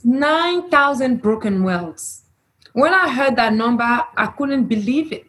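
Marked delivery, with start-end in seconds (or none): none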